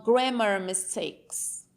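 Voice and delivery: ordinary delivery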